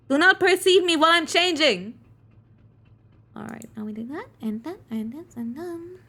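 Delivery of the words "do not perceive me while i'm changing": much higher and louder, prim outrage; high scandalized character. Acted. Character scolding onlookers for peeking, high and loud. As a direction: scold them like a shocked prude.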